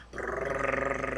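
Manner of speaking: long rolled buzzing trill; drawn-out brrr sound effect; imitated noise. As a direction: imitate an engine-like rattle. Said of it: "all you hear is".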